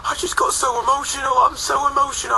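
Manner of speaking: emotional tour manager. Retold or imitated. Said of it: "crying his eyes out".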